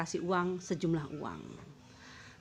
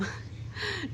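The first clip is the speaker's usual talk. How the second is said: high laugh; 6 dB quieter than usual. Real, not acted. Laugh while telling story.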